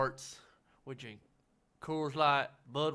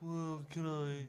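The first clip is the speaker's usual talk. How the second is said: snooty waiter; slower than usual. Retold or imitated. Friend mimics snooty waiter.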